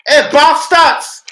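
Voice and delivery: slow loud high yell; shouting out window. Real, not acted. Man yelling out the window at passers-by, loud. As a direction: bellow out the window at people.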